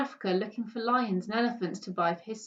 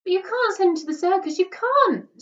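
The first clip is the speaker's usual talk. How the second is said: high, loud pleading cry; a boy begging against the circus; tearful high protest; desperate protest; 11 semitones higher, 9 dB louder than usual. A narrator's reading. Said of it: "said Bertie".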